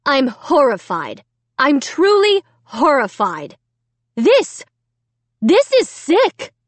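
building to a high loud cry; outraged mother; horrified scolding. A narrator's reading.

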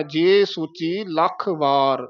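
slow ਜੇ ਸੋਚੀ ਲੱਖ ਵਾਰ, ਵਾਰ on its own; words kept separate. Shown as a demonstration. Teacher repeating the whole phrase, slow and separated. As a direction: draw it out, keeping ਵਾਰ distinct. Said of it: "ਵਾਰ ਵੱਖਰਾ ਬੋਲਣਾ ਹੈ".

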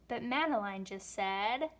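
usual speaking voice

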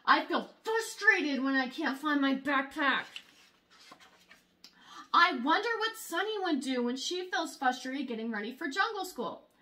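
usual speaking voice

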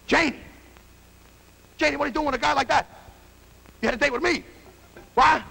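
high, rushed jealous outburst; shocked protest. Acted. Young fighter finds his girl with another guy, indignant. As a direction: cry out in indignant disbelief.

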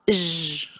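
"j" as a long buzzing zh; isolated j sound. Shown as a demonstration. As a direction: hold "j" alone as zh, not the letter name. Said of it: "C'est le son". (« j » prononcé comme un son seul, prolongé)

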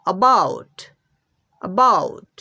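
অ্যাবাউট with a weak first অ্যা; dictionary pronunciation. Shown as a demonstration. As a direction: model অ্যাবাউট, light first syllable. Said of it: "আমরা পাচ্ছি". (অ্যাবাউট দুর্বল প্রথম অক্ষরে)

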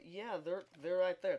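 ordinary talk

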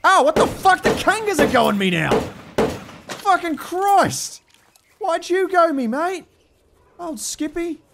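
high, loud startled yelling; alarmed outcry. Real, not acted. Player attacked by kangaroos, high loud alarmed yelling. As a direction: yelp as if bitten.

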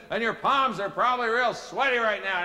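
loud, high preacher's challenge; meeting leader's call. Retold or imitated. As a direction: call it out urgently to the whole room. Acted meeting leader pressing on.

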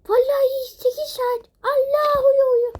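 high, loud frantic cries; frantic disbelief. Real, not acted. Woman shocked at sleeping eight hours, high frantic cries. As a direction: shout it out in panic. Panics about oversleeping.

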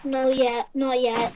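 usual speaking voice

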